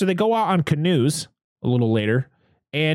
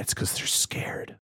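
whispered, slow warning; spooky hushed hint; ominous whisper; 9 dB quieter than usual. Retold or imitated. Jack warning about the fish, whispered. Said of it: "And Jack goes".

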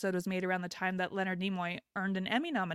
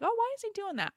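puzzled; 13 semitones higher, faster than usual. Retold or imitated. Retold puzzled thought.

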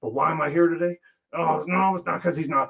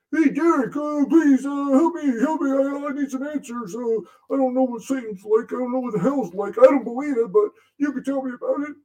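high, pleading whine, much higher throughout; whiny creator impression; 12 semitones higher, 4 dB louder than usual. Demon mocks his creator begging him about Satan, high whining. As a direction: beg like a helpless man who needs answers. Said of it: "he kinda sounds like that. He whines a lot".

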